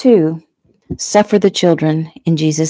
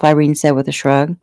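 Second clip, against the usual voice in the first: ordinary delivery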